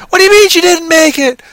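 high, loud hammed-up disbelief; mock alarmed outburst. Film character learns Karen is lost, mock alarmed outcry. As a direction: burst out in overacted shock. Acts character hearing of a death.

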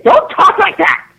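very high, drawn-out mocking scold; snippy. Annoyed coworker scolding two women playing characters, imitated as snippy. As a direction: imitate a snippy woman telling them off. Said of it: "snippy thing".

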